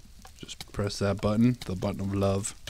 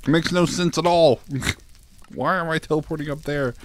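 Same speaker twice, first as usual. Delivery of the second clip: exasperated outburst; 7 semitones higher, 3 dB louder than usual. Real, not acted. Baffled by game glitch.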